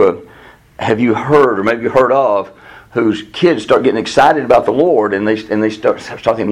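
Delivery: usual speaking voice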